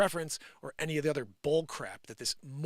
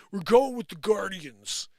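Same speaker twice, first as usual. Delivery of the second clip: slow, louder pompous mock official; mock team announcement; 5 semitones higher, 9 dB louder, slower than usual. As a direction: play the owners announcing it, hammed-up. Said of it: "And they were like".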